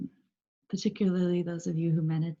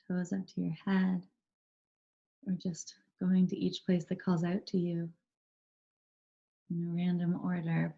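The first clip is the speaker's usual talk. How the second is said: calm, even meditation guide with long pauses; unhurried, steady voice guiding a body scan; guided meditation. Real, not acted. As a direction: lead them gently into stillness, leaving space between phrases.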